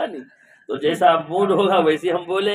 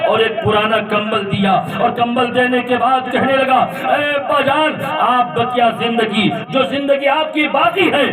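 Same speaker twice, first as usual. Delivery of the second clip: preaching at full force; 10 semitones higher than usual. Acted. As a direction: preach the tale with rising fervour. (جوش سے بلند آواز میں واعظانہ قصہ گوئی)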